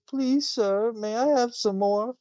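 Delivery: high, small pleading orphan voice; Oliver Twist plea. Retold or imitated. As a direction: beg like a starving little orphan. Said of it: "Oliver Twist goes up and says".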